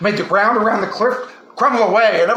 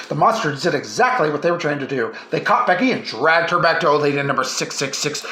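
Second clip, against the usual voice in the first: lower, hushed menacing narration; ominous narration; 4 semitones lower than usual. A narrator's reading. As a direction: tell it like a campfire horror tale.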